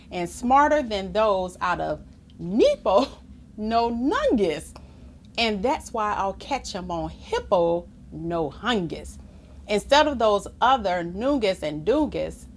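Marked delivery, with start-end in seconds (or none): none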